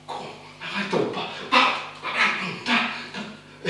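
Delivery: whispered panting. Acted. Runner urging himself on in a whisper. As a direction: gasp it out in a whisper.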